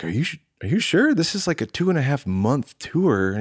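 high-pitched imitation of a doubting friend; incredulous questioning. Friend questioning his refusal.

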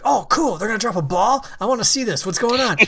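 put-on high, eager childhood enthusiasm; retold excitement. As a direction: act out a kid getting excited. Retelling childhood excitement at ball drop.

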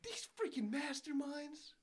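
high, drawn-out starstruck praise; awed. Retold or imitated. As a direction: gush over their genius. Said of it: "I'm like".

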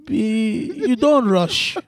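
animated Yoruba man, pitch swinging; Yoruba accent. Pretend. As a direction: reason aloud in a Yoruba accent. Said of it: "mine is a Yoruba man".

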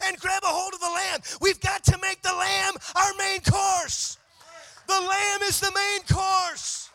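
high, loud, shouted preaching with musical lift; full-force preaching. Acted. Pastor shouting over the organ to the congregation, full-force preaching. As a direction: preach it hard, building to a roar.

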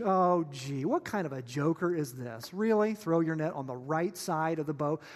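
low, incredulous grumble; dry mock disbelief; sarcastic. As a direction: scoff at ridiculous advice, pitch dropping. Fishermen mock the stranger's advice.

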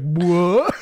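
loud startled what; a jolted Tiberius gasping what; shocked. Retold or imitated. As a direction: blurt it out in mock shock. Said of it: "like, shocked".

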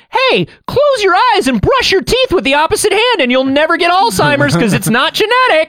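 high, sing-song mock voice; mocking blog voice. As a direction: parody a cheesy wellness tip. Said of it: "blog posts that are like".

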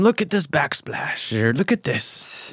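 slow, lightly posh British disdain; British chef complaint. Retold or imitated. Imitating Ramsay mocking a hotel bathroom, lightly British. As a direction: sneer at the backsplash like a snob. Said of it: "talks shit on the bathroom".

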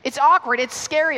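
normal talking voice

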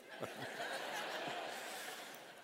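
soundless laugh shaking through; breathy silent laugh. Real, not acted. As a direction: laugh it off with barely any voice. Laughing at his own story.

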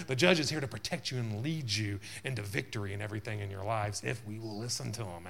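ordinary delivery

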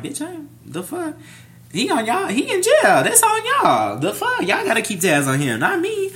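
very high, squeaky protest; retold indignant retort. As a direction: act out high-pitched outrage, pushing the blame back.

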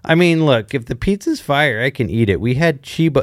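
ordinary delivery

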